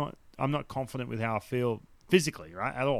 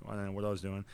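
ordinary talk, nothing marked

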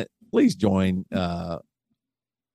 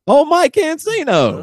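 excited exclamation; 5 dB louder than usual. Acted. Announcing the last name.

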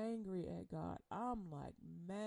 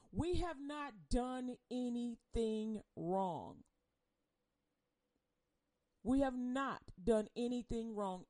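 louder, emphatic protest of innocence; indignant protest; 2 semitones higher, 4 dB louder, slower than usual. Acted. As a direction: protest it firmly, stretching the words.

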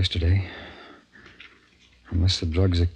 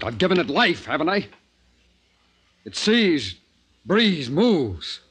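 higher, rapt and triumphant; feverish excitement; 7 semitones higher, slower than usual. Acted. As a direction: marvel at your own creation. Scientist marvels at his creation.

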